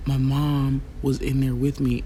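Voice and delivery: usual speaking voice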